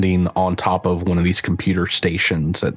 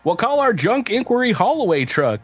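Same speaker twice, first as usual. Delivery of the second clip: infomercial announcer; 7 semitones higher than usual. Acted. AI ad for junk truck.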